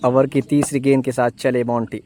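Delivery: ordinary talk, nothing marked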